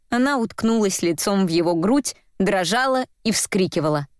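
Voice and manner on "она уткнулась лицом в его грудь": ordinary delivery, nothing marked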